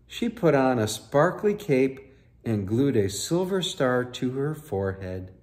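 put-on lively read-aloud for children; story narration. A narrator's reading. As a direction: tell it like a bedtime picture book. Reading picture book aloud.

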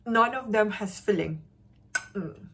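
chewing between words; talking around food; mouth full. Real, not acted. Seen 0: Bite at -5; food visible in mouth at +0.8 while speaking.

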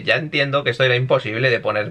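usual speaking voice

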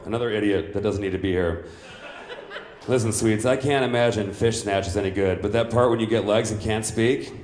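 ordinary delivery

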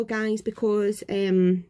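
ordinary delivery

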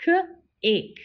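K-ake split into onset and long vowel; segmented. Shown as a demonstration. Teacher sounding out K-ake for the class. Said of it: "Say it with me again".

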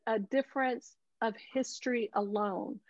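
ordinary delivery

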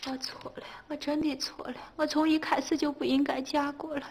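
high, plaintive keening; woeful, self-pitying litany; self-blaming lament. Acted.

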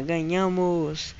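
slow, stretched "we won" at normal volume; easy, lingering victory call; drawn-out celebration. Real, not acted. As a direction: draw the word out without shouting. Repeats the win after cheering.

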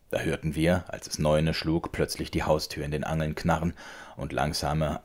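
ordinary delivery

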